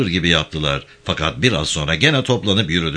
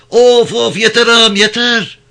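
much higher, louder mock cries of pain; fake wailing; 8 dB louder than usual. Prisoner faking cries so the guards think he's beaten. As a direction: pretend to be beaten, wail it out. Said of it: "Bağır hadi".

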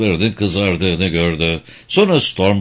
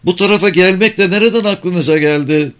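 high, lively shouted complaint; calling out; 9 semitones higher than usual. A narrator's reading. Daughter scolds father for riding so far, calling out. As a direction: keep calling out, pitched well up. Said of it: "diye bağırdı".

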